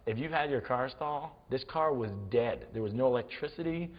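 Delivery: usual speaking voice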